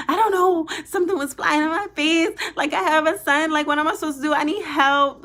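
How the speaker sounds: replaying her own freakout on the phone; retold frantic panic. Caller begging the dispatcher for help, frantic and loud.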